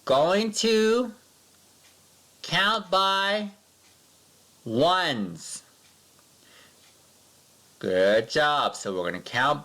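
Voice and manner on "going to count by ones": put-on slow, high, spaced-out words for kids to repeat; model sentence for class. Shown as a demonstration. Teacher models a sentence for pupils, slow and high. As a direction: say it slowly so the class can echo.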